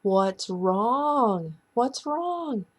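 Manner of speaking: high-pitched, worried questioning; acted anxious aunt; retold alarm. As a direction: ask urgently, pitch climbing on the repeat. Alarmed aunt answers bawling niece.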